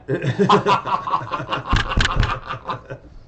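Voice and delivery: long, high cackling villain laugh; villainous laugh. Acted. Supervillain after his own boast, hammed-up evil cackle. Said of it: "That was my villainous laugh".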